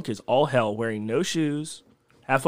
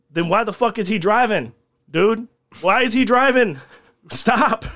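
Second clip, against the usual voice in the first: high, raised voice of retold outrage; exasperated yelling; 8 semitones higher, 5 dB louder than usual. Cook confronting friend of drunk driver, exasperated raised voice. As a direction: demand it in loud disbelief, building.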